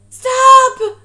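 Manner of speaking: a sudden, high, very loud yell; cried out. A narrator's reading. Boy yells at the swim team to stop. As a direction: shout it to stop them in their tracks. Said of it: "cried Lewis".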